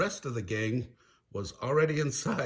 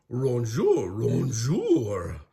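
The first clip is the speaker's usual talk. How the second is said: loud cartoon dog greeting; dog voice attempting French, punched out; Scooby as French chef; 5 dB louder, much slower than usual. Scooby disguised as a French chef, loud doggy greeting. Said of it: "said Scooby-Doo".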